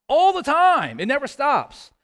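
high, loud preacher's answer; emphatic exclamation. Real, not acted. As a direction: burst out with the answer. Preacher answers his own question.

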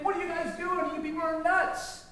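high-pitched exasperated rant, not full volume; mock screaming at the television. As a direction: rant helplessly at the TV news. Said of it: "were you screaming at the television set".

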